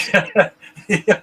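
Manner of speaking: loud high burst of laughter. Real, not acted. Laughs at own joke.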